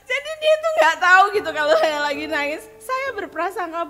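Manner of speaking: giggling high through the quote; laughing. Real, not acted. Quoting her husband's puzzled question while laughing.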